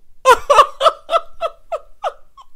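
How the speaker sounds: long, high, loud cackle; hollering with laughter. Real, not acted. Cracking up at a husband's funny quote, hollering laughter. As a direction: lose it completely, loud and high. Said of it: "When I tell y'all".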